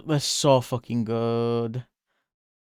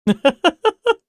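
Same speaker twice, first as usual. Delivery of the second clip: a shrill squeaky laugh, much higher; high squeal laugh; 8 dB louder than usual. Real, not acted. Laughing after a joke.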